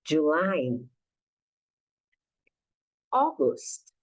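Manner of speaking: each month slow with pauses to repeat; teacher drilling the months; slow model for repeating. Shown as a demonstration. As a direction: model the months again for practice. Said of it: "ouça a minha voz mais uma vez".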